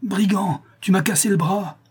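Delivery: very high-pitched accusing cry; high, agonised exclamation; pained outcry. A narrator's reading. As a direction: cry out at the injury, outraged. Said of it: "s'écria-t-il".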